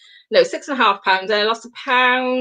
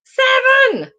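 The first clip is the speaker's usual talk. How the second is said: indignant disbelief; 13 semitones higher, 4 dB louder than usual. Real, not acted. Disbelief at seven points.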